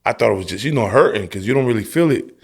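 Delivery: normal talking voice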